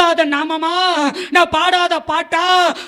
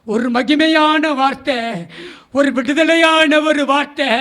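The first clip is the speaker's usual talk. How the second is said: ordinary delivery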